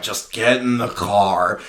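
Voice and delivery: low, slow old-man impression; weary command to his younger self; old man's order. Old man's gruff retold reply.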